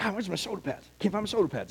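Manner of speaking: low, very soft mutter; muttering to himself. Acted. Player hunting for shoulder pads, muttering to himself. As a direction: mumble it while searching around. Dreamer searching for missing gear.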